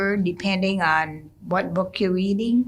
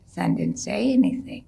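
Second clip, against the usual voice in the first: normal talking voice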